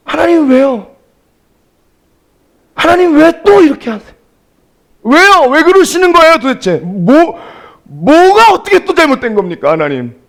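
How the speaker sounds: high, loud pleading protest; complaining to God. Retold or imitated. Preacher reenacting his complaint to God in the hospital hallway, loud protest. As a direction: cry out why at God, aggrieved.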